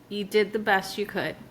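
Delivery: low, soft reassuring voice; gentle self-advice. Acted. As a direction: comfort your younger self. Speaking to younger self.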